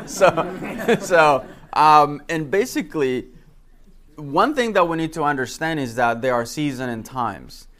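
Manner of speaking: ordinary delivery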